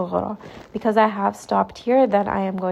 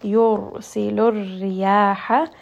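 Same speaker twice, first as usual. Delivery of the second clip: quick chanted tajweed recitation; تلاوة مجودة; Quran recitation; 3 dB louder, much faster than usual. Shown as a demonstration. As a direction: recite "يُرْسِلُ الرِّيَاحَ" with a heavy rolled ra. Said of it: "as we are reading this letter".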